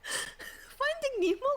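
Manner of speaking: slow high incredulous question; drawn-out disbelief. Real, not acted. Disbelief at crying over Nemo.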